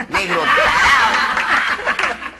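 agudo, muy lento y fuerte; burla del mono. Acted. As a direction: cantarle el insulto para provocar. Mono insulta, estirado. (el mono se burla del negro, alargando)

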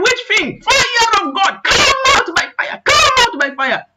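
high, forceful commands; exorcism prayer. Retold or imitated. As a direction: drive the spirit out as the praying mother. Mother commanding spirit out in prayer.

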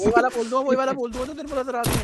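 loud teasing urge. Real, not acted. Egging a friend to swear.

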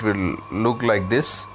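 ordinary delivery

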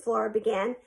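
ordinary delivery, nothing marked

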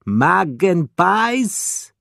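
"Magpies" said as "magen-pies", high and questioning; mispronounced repeat. A narrator's reading. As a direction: stretch "Magpies" into three parts, MAG-en-pies, unsure. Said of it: "Spiro repeated".

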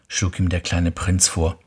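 ordinary delivery, nothing marked

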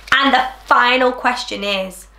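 ordinary delivery, nothing marked

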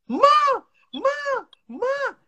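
put-on high whiny kid calling ma over and over; child calling ma. Mother imitating her son calling for her. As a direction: mimic a needy child calling for mom. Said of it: "every two minutes".

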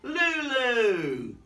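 high, loud, stretched-out call; storybook dad calling, drawn out and high; calling daddy. Acted. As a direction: sing out the name to a small child. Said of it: "says Daddy".

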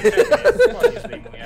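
very high giggling squeak; laughing squeal. Real, not acted. Joking squeal.

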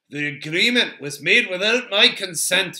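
affronted dwarf's objection; indignant Gimli. A narrator's reading. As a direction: protest loudly at being singled out. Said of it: "not at all to the liking of Gimli".

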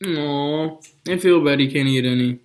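talking while chewing food; speaking between sandwich bites; mouth full chewing. Real, not acted. Seen 3: Bit sandwich at -3; lips closed, sandwich in hand throughout.

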